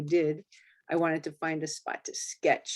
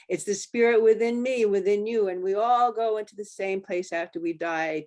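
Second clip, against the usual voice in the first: louder, higher, swept along; rising fervor; 5 semitones higher, 6 dB louder than usual. Acted. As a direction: let the spirit speech swell up. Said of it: "words poured out of my mouth like a torrent".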